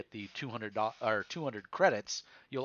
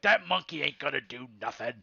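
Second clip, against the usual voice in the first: thug sneering; 4 semitones higher, 7 dB louder than usual. Acted. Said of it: "the big guy's like".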